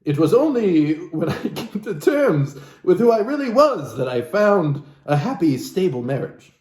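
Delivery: normal talking voice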